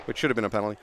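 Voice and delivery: ordinary talk, nothing marked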